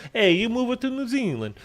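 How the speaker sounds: playful Italian-accent impression of a buddy. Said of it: "it's like I'm Italian".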